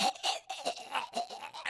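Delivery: imitated strangled gagging, voiceless; choking gasps. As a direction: mimic being choked, in short gasps. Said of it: "begins choking the shit out of him".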